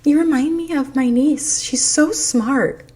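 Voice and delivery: high put-on flattering voice, stretched at the end; mock buttering-up. Line-cutter flatters shopper to get ahead, mock buttering-up. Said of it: "she was trying to butter me up".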